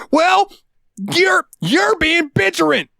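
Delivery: high stammering falsetto; mock customer voice. Cashier mimics a flustered customer in a high falsetto. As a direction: stumble over it like a flustered lady. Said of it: "and says".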